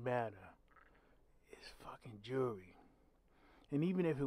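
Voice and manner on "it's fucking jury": muttered under his breath, unvoiced and hushed; whispered aside. Real, not acted. Vlogger grumbling to viewers about the killers, whispered. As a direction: drop to a bitter whisper.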